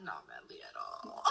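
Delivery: very low, slow self-mocking drop; mock manly. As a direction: mimic yourself in a deep, dragged-out voice. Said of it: "Ew, the way I said that".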